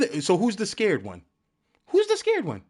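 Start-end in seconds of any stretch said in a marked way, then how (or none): 1.9-2.6 high squeaky taunt; mocking squeak. Taunting chat in a squeaky cartoon voice.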